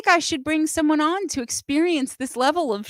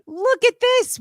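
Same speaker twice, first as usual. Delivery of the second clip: excited; 12 semitones higher, 7 dB louder, slower than usual. Real, not acted. Excited reveal of guests.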